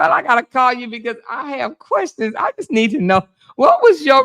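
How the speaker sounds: high-pitched giggly questions through laughter; laughing excitedly. Real, not acted. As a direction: laugh while you demand answers. Laughing at shocking gossip.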